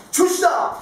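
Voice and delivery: high, sudden exclamation of recognition; leaping up in pitch with excitement; excited cry. Acted. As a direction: cry out as you recognize him. Peter recognizes the Lord.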